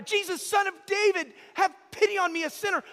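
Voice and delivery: loud, high plea for mercy; desperate crying out. Acted. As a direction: call out over the crowd, begging. Blind man cries out to Jesus.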